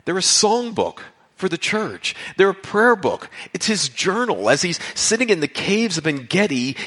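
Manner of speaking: ordinary talk, nothing marked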